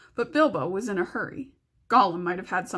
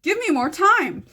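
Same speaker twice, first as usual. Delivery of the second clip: pleading shout; 8 semitones higher, 6 dB louder than usual. Acted. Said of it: "He wanted to shout".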